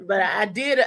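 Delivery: normal talking voice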